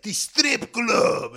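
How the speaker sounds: very slow mock Drago accent; Hrgovic's Russian-sounding accent. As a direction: drag each word out in a comic Russian accent. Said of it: "It's like Ivan Drago".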